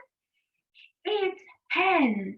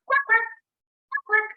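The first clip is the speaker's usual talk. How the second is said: high, short quacks in pairs; duck quack; 8 semitones higher, faster than usual. Acted. Teacher quizzing children on animal sounds, imitated duck quack. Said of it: "An animal that says".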